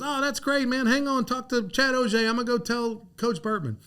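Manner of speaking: high, delighted mock voice; excited Randy. Coach hearing the recruit commit, high and thrilled. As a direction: bubble over with the good news. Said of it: "He goes".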